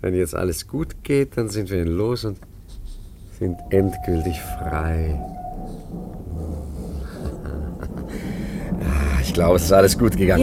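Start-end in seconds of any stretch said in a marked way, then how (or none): 5.2-8.8 soft airy laugh under the breath; quiet breathy chuckle. Acted. Robert lacht über gelungenen Plan. (leises, hauchiges Kichern)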